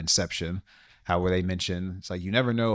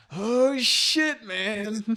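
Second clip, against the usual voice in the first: high-pitched, overwhelmed reaction to a sad film; acted-out choked-up moviegoer; mock tearful; much slower than usual. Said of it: "shed a tear".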